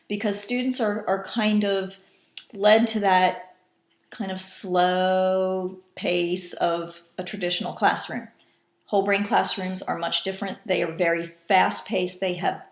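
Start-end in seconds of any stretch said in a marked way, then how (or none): none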